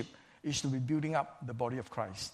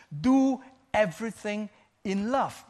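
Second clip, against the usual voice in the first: forceful preaching; 6 semitones higher, 7 dB louder than usual. Acted. Preacher quotes Paul's command.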